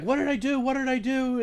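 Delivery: high, frightened imitated plea; bewildered pleading. Shot man to the cop, high frightened pleading. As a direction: play the shot man's shocked disbelief. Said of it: "the guy's like".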